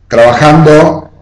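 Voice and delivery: usual speaking voice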